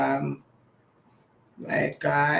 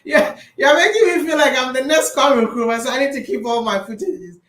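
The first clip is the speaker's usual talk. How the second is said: laughing; 7 semitones higher, 5 dB louder than usual. Real, not acted. Laughing, repeats the joke.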